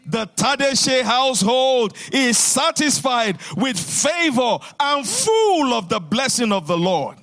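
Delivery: firm, rhythmic proclamation for the church to echo; declaring confessions. Real, not acted. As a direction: declare each line like a promise already won. Leads congregation in spoken confessions.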